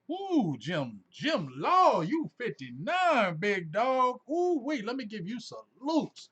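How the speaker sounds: high, loud, drawn-out whooping praise; big high-pitched shout-out; amazed hype. Real, not acted. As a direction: holler in amazement, stretching the words. Amazed at viewer's age, salutes him.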